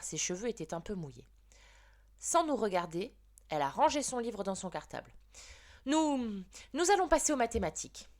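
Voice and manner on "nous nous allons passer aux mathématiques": stammered. Acted. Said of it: "a-t-elle bredouillé".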